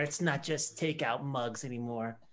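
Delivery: ordinary talk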